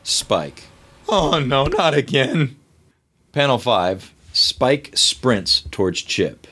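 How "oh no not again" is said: alarmed. Acted. Spike alarmed.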